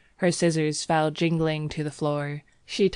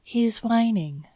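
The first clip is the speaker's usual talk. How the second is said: put-on high, slow, uneasy young woman; nervous; 6 semitones higher than usual. A narrator's reading. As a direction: voice the girl with mild nerves. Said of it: "she continued nervously".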